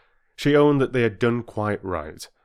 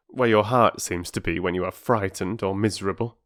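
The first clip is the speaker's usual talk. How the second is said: plain reading voice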